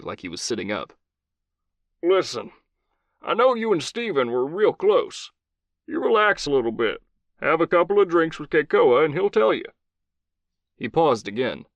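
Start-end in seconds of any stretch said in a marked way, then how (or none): 2.0-9.7 gruff Southern commander, higher voice; old Southern cowboy voice. Acted. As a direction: play a blunt old cowboy soldier.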